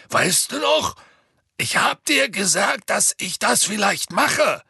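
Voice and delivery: high, loud calling up to a giant; Hagrid. A narrator's reading. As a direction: insistently remind someone far above you. Hagrid loudly reminding the giant. (lautes, eindringliches Erinnern)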